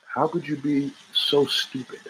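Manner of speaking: cold quiet anger. Retold or imitated. To a girlfriend, cold and quiet, not raising his voice. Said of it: "and I didn't raise my voice".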